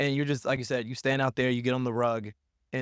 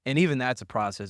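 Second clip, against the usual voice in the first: ordinary delivery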